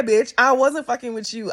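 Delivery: usual speaking voice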